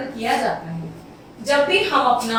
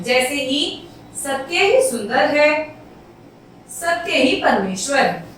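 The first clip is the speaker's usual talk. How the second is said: slow, with a long pause between the clauses; longer pause; slower than usual. Shown as a demonstration. Teacher showing the semicolon pause to the class. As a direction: hold a longer break before going on. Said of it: "अल्प विराम से हमने थोड़ा सा ज्यादा रुक गए।".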